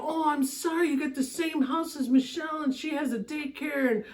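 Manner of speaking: high-pitched, rambling apology; retold apology. New neighbor explaining to a staring stranger, high-pitched apology. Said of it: "I go".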